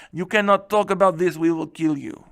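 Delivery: mock-Russian king laying down the law; hammy Russian accent. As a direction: threaten in a put-on Russian accent. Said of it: "my Russian accent is on".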